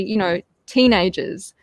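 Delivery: usual speaking voice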